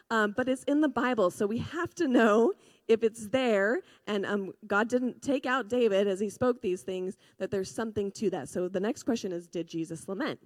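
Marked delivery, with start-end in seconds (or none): none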